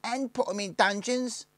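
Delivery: very high, loud chiming in; high-voiced gang boy. A narrator's reading. William Tell backs the leader's threat, high loud voice. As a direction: add the threat eagerly, pitched right up.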